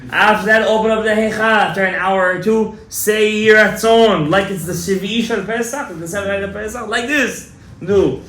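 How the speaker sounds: loud, high, urgent preaching; preaching at full force. Acted. Rabbi urging the congregation to pray that night, in full preaching force. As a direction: drive every instruction home to the crowd.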